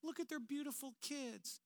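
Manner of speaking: high, soft, drawn-out "Oh my gosh"; gushing admirers. Retold or imitated. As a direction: coo like an impressed onlooker. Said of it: "And then people go".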